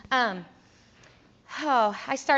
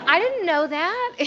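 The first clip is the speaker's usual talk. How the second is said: high, slow protest through laughter, louder; laughing protest; 8 semitones higher, 7 dB louder, slower than usual. Retold or imitated. Retelling the sleeveless-shirt panic, high slow laughing protest. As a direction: protest innocence while laughing. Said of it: "I was like".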